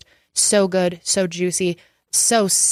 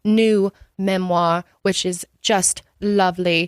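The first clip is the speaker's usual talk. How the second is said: put-on British accent. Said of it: "You have five more minutes of accent".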